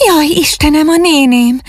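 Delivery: high frightened cry, suddenly remembering; startled cry. A narrator's reading. Ágnes waking and remembering her aunt, crying out in alarm. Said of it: "kiáltott felietten".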